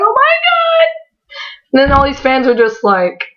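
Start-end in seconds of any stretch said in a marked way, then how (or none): 0.0-0.9 very high squealed exclamation; fangirl squeal. Retold or imitated. Fan reacting to being remembered, a retold high squeal. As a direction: mimic an overwhelmed fan, voice shooting sky-high.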